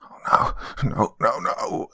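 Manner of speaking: breathy, half-voiced cries of dread; acted horror, voice mostly breath; horrified. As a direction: gasp it out as the horrified old lady. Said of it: "In horror, she dropped her shears".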